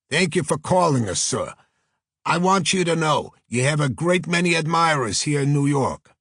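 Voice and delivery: high, loud, effusive Brooklyn agent; Brooklyn accent. Acted. New York agent thanking famous detective by phone, strong Brooklyn accent. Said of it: "a voice with a strong Brooklyn accent".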